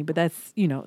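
normal talking voice

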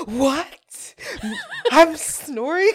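high, incredulous mock question; half-asleep shock. Imitating a friend told she snores, sleepy disbelief. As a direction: imitate a sleeper stunned by the news. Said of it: "like, half still asleep".

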